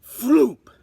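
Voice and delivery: sudden loud sound word; punchy comic floop. A narrator's reading. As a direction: burst out the landing noise. Bat lands in nest.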